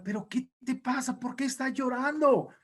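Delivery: high-pitched, hammed-up questioning; worried demon minions. Demons question crying Satan, high fussing voice. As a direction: play an underling fussing over the weeping boss. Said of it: "llegó todo su séquito de demonios".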